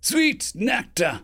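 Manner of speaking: slow, high, drawn-out cry of delight. Acted. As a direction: savor each word with joy.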